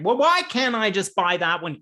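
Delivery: impatient player. Retold or imitated.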